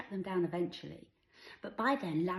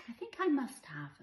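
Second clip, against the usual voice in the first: put-on mum answering her child; 8 semitones higher, 5 dB louder than usual. A narrator's reading.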